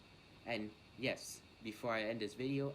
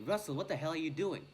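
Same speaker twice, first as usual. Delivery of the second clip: sharp, raised, higher voice; mock teacher yell; 5 semitones higher, 6 dB louder than usual. Teacher yelling at Russell over crumpled paper, mock shout. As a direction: bark it out like an annoyed teacher. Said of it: "she yelled at me".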